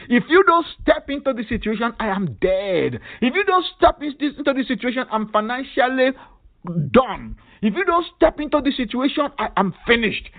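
high, urgent pleading, rising on each if; desperate cry to God. Shown as a demonstration. Preacher showing the congregation how to cry out, high and urgent. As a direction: cry out like your life depends on it. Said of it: "it requires you to cry".

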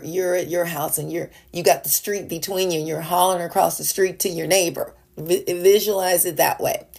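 normal talking voice

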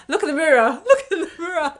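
laughing through a mock comeback. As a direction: fire back while cracking up.

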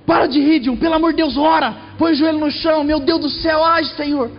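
mother scolds John for laughing, high shouting; scolding mother. Retold or imitated. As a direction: snap at him to stop laughing.

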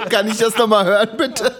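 high, loud request through laughter; laughing. Real, not acted. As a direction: ask while cracking up. Cracks up at the sneeze demo.

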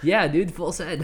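laughing hyped bro shout; loud laughing party bro; frat bro. Retold or imitated. Mocking reckless influencers.

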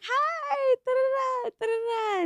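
very high, squeaky made-up greeting; squeaky mock greeting. Retelling her nervous hello to the girlfriend, very high and squeaky. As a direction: squeak hi like an overexcited girl.

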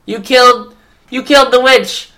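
high, loud mock celebration; mock cheering. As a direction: cheer for Dorothy, hammed up. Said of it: "they're like".

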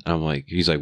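ordinary delivery

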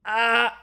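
high bleating "ah"; imitated sheep scream. Friend imitating Amari's scream, mock sheep scream. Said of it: "he screams like a sheep".

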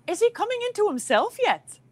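high-pitched imitation Irish lilt; attempted Irish brogue. Irish shop lady asking about a baby, imitated brogue. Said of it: "they have these brogues. I can't do it".

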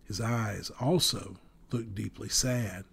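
ordinary delivery

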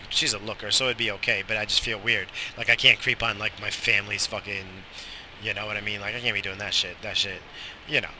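normal talking voice

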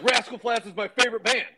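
high-pitched imitated amazement; retold excited disbelief; starstruck fan. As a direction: gush it like a stunned fan.

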